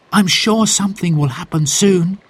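put-on high, hopeful young child; a young girl, eager. A narrator's reading. Child character voice.

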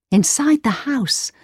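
plain reading voice

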